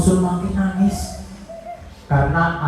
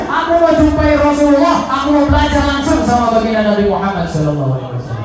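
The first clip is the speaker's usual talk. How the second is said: higher, sing-song proud villager; boastful Bedouin; 6 semitones higher than usual. Acted. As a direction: brag like a simple proud villager. Bedouin boasting, chanted high.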